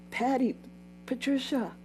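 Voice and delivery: high, excited imitation of a coworker; retelling a coworker's baby news in a mock excited voice. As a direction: play the thrilled coworker calling her name. Coworker's voice in retold story.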